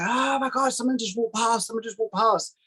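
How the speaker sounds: high-pitched retold panic without a full shout; freaked-out partner, voiced high; mock panicked scream. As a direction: imitate his frightened scream, high and held back. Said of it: "he screams at the top of his voice".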